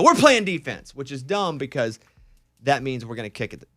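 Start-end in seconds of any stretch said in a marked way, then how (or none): 0.0-0.9 eager call. Retold or imitated. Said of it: "And I go".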